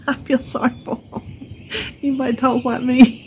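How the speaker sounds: high, laughing self-mockery; real laughter breaking up the words. Real, not acted. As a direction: crack up at your own joke. Laughs at her own accent joke.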